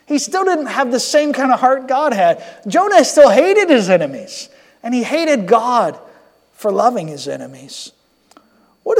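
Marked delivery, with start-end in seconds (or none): none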